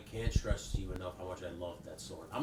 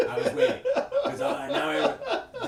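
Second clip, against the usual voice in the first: laughing; 11 dB louder, slower than usual. Real, not acted. Laughing remembering the scream.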